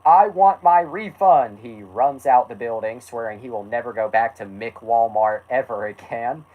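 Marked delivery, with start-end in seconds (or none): 0.1-1.6 high, loud, drawn-out demand; hammed-up angry customer's yell. A narrator's reading. Carl demands money back from store, acted yell. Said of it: "He yells and screams so loud".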